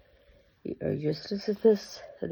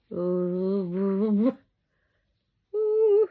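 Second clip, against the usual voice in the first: delighted ooh; 3 dB louder than usual. Real, not acted. Cooing over the doll's soft hair.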